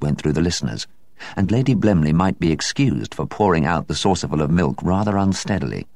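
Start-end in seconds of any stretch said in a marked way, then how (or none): none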